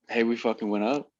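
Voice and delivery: ordinary delivery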